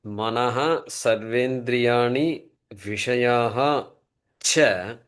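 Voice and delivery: very slow chant-like reading of the Sanskrit; slow recitation. Shown as a demonstration. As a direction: read the verse slowly for the class to repeat. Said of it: "Watch the moolam".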